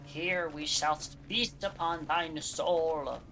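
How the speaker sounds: mad scientist. Pretend. Taunting fleeing enemy as villain.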